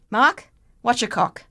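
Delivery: high, hammy Cockney greeting; bad Cockney accent. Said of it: "like the worst Cockney accent I've ever heard".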